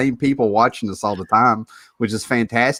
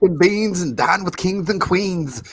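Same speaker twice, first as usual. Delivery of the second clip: higher-pitched hammed-up wrestler promo; Dusty Rhodes impression; 6 semitones higher than usual. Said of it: "I could have went Dusty Rhodes on you".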